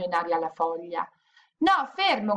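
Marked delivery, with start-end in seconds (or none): 1.6-2.4 high urgent cry; shouting stop. Acted. Zaccarina stops the wolf throwing the leaf, high urgent shout. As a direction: call out to stop him at once. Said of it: "gridò Zaccarina".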